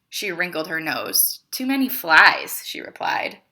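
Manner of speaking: lively read-aloud to a class; story narration. A narrator's reading. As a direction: read it like a picture book for kids. Teacher reads picture book aloud.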